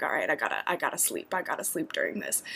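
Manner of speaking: low, soft sleepy murmur; drowsy mutter. Retold or imitated. Telling herself to sleep on the flight, low and soft. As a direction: mumble it half asleep. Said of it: "I was like".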